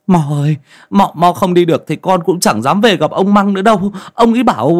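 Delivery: high, loud, whiny begging; distressed young man's high voice; anxious pleading. A narrator's reading. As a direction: backpedal hastily and plead. Young man hastily corrects himself, begging.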